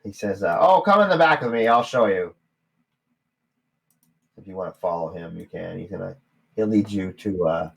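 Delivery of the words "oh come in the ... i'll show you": gnome barkeep. Acted. Gnome invites.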